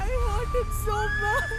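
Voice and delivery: crying. Acted. Maya breaking down over father.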